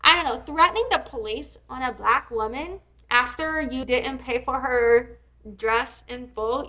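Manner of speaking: ordinary talk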